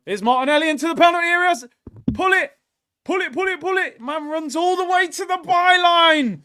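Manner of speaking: high, fast, yelling at the screen; willing the winger on at full volume; frantic match-watching yell; excited shout. Real, not acted. Excited live watchalong commentary.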